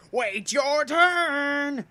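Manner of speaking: high, loud monster shout; demonic shout. A narrator's reading. Evil rat mascot snapping at the children, demonic shout. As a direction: bellow it like a possessed toy. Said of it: "in a low-pitched, demonic voice".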